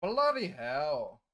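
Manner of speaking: drawn-out, loud British accent attempt; slow, loud mock-British, slipping into Scottish. Shown as a demonstration. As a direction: put on a British accent that lands nearer Scottish. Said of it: "say that with a British accent".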